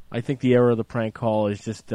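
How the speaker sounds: usual speaking voice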